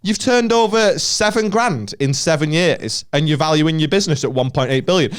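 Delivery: hammed-up investor mocking a silly valuation. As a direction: grill the pitcher like a TV dragon. Mock Dragon investor grilling pitcher.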